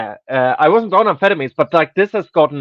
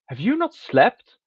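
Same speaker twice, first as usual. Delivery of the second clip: higher, slow incredulous question; retold disbelief; 7 semitones higher than usual. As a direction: ask it in mild mock disbelief. Retold friend's astonished morning question.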